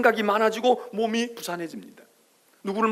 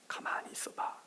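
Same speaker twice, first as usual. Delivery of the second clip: barely breathed, unvoiced 가만히 있어봐; whispered hush; 17 dB quieter than usual. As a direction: whisper it like a secret command. Hushed command in sermon. (속삭이듯 숨소리로만 "가만히 있어봐")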